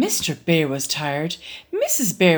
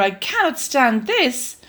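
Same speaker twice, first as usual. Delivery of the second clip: weary Mister Bear; 7 semitones higher than usual. Acted. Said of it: "said Mister Bear".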